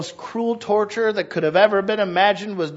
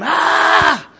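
put-on baby scream; 11 semitones higher, 7 dB louder than usual.

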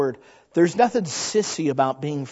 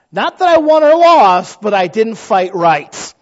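much higher and louder; loud raised insistence; emphatic burst; 4 semitones higher, 11 dB louder, slower than usual. Real, not acted. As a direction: blurt it out with force. Emphatic aside.

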